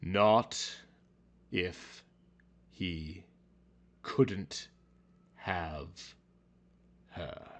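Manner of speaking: each word split by long pauses; slow word by word. A narrator's reading. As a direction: drop to near silence word by word. Slow dramatic word-by-word delivery.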